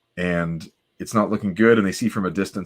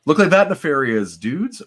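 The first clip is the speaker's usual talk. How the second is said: rising puzzled reread of the line; mock puzzled echo; 7 semitones higher than usual. Real, not acted. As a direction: question the odd wording. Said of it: "I don't even understand that phrase".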